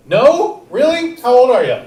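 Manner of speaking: high, loud disbelief; mock surprise. Real, not acted. Host teasing a young audience member, high and loud. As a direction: act amazed at his answer.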